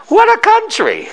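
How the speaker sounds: very high, delighted acted wonder; imitating grandmother's astonished delight; amazed exclamation. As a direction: exclaim it high with a laugh, marveling. Acts grandmother's amazement at free school.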